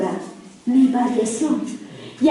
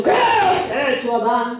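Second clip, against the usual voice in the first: wrong angry tone; 3 semitones lower than usual. Acted. Preacher showing how God did not speak to Adam, mock scolding. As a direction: stretch QUÉ into an accusing mock outburst. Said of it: "No le ha dicho".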